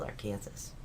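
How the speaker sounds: Arkansas read by its spelling, rhyming with Kansas; said as spelled. Shown as a demonstration. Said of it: "It is spelled Arkansas".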